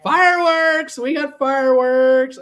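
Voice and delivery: high, loud cheer over the fireworks; excited cheer. Real, not acted. Host showing viewers his fireworks, cheering high and loud. Setting off noisemaker fireworks on stream.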